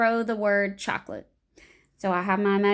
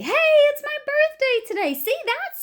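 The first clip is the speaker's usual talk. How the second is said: high, loud, bright-hearted announcement; cheery birthday news; 5 dB louder than usual. Shown as a demonstration. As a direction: share the good news to cheer her up. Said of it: "then I might say".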